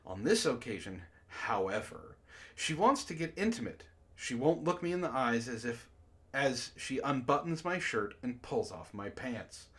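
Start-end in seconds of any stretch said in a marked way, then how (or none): none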